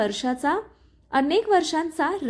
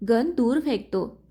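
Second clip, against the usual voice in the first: ordinary delivery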